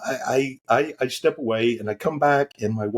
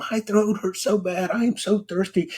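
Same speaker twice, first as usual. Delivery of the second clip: wife complaining; 9 semitones higher than usual. Retold or imitated. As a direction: imitate her whiny sore-throat plea. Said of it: "she's saying".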